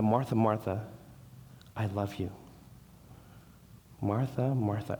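God lovingly calling Martha; loving divine call. Retold or imitated. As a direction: say her name with quiet love. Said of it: "it respect says".

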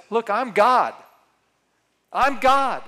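loud, high proclamation of being God; proclaiming. Acted. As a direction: declare it boldly as Jesus. Preacher voicing Jesus' claim.

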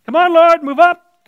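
loud, high, impatient plea; mock-impatient shout at God; impatient demand. Acting out impatient prayer to God.

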